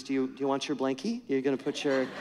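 soft, quick sweet teasing; very sweetly. Retold or imitated. Wife teasing her husband at bedtime, soft and sweet. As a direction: coo it at him like a pet name. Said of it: "very sweetly looks at me".

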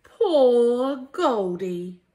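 usual speaking voice

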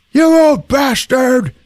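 high, loud, drawn-out mock fury; outraged yell. Pranked man to Reggie, outraged yell. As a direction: holler it in hammed-up outrage.

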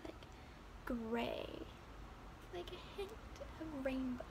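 hushed storytelling. A narrator's reading. Narrating the spooky cloud land, hushed whisper. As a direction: breathe the description like a secret.

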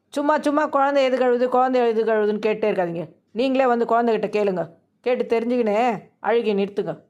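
exasperated wife. Acted. Wife tells husband to ask the crying baby himself, exasperated and rapid. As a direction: fire it back, fed up with being asked.

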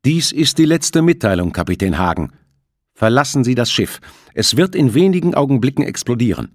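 cold, stern radio voice; stern metallic voice. Acted. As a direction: announce it like a cold threat. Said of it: "die ernste, metallische Stimme von Delacroix".

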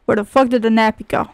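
ordinary talk, nothing marked

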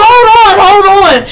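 imitating a high-pitched holler; panicked mock call. Retelling a man calling to a woman in the wind, high mock holler. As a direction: call out high and loud, hammed-up.

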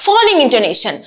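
normal talking voice

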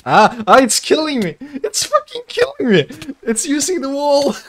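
high frantic screams; panicked screaming. Real, not acted. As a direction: shriek like you're under attack. Llama attacking him.

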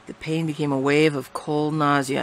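ordinary delivery

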